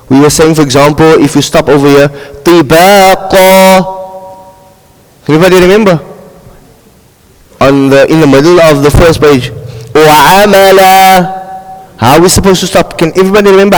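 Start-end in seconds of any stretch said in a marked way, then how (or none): 2.4-4.0 tibaqa chanted slowly, final vowel left open and hanging; stop in mid-air. Shown as a demonstration. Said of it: "stopping in mid-air". 9.9-11.4 Wa amala chanted slowly, last vowel left hanging; stop in mid-air. Shown as a demonstration. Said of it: "In mid-air, that's the word".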